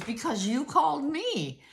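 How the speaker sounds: amused clerk laughing through it; laughing reply. Retold or imitated. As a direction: say it while cracking up. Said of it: "he busted out laughing".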